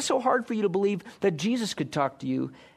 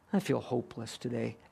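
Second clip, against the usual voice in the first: resigned agreement; 5 semitones lower, 7 dB quieter than usual. Retold or imitated. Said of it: "you'll be like".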